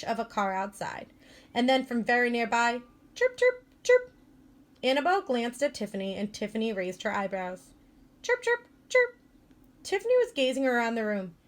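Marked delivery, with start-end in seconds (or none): 3.1-4.1 high squeaky chirping; cricket chirps. A narrator's reading. Narrator imitates crickets chirping nearby, high squeaky chirps. 8.2-9.2 high squeaky chirping; cricket chirps. A narrator's reading. Narrator imitates crickets chirping nearby, high squeaky chirps.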